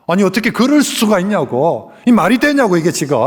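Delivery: high, loud outraged objection; loud high protest, peaking then easing; indignant protest. Retold or imitated. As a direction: Peter protesting hotly. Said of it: "막 지금 베드로가 항변을 하고".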